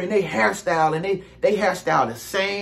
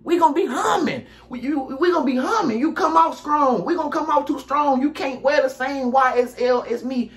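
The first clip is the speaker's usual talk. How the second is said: lightly voiced copycat, higher and showing off; copycat friend; 5 semitones higher than usual. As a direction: play the copycat bragging he smells the same. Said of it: "they gonna show you".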